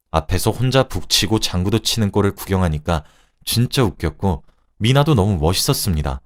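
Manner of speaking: plain reading voice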